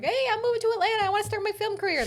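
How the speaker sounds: very high, fast mock-eager voice; cute naive newcomer. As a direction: squeak it like an over-keen hopeful, mocking. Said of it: "these cute little posts that are like".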